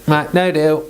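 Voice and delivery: ordinary delivery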